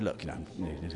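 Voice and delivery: put-on soft, low, very polite English accent; best English accent. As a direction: imitate your most courteous English accent, hushed. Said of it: "the best English accent".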